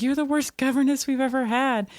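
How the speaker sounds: high-pitched mock scolding, no real volume; retold yell. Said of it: "Missus Pound yells at her".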